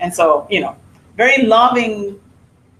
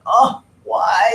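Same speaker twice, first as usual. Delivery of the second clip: imitated groggy complaint. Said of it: "my husband would be like".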